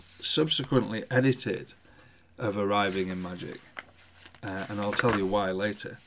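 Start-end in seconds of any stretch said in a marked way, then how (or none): none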